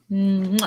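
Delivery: loud smacking air kiss; kiss sound. Real, not acted. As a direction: blow a playful kiss. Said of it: "You want me to blush?".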